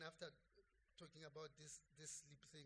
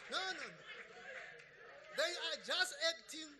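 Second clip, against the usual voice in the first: loud, higher, emphatic preaching; forceful preaching; 9 semitones higher, 12 dB louder than usual. Real, not acted. Preacher driving home a point to church, forceful preaching. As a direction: preach it out to the congregation with fire.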